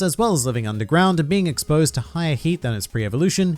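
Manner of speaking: normal talking voice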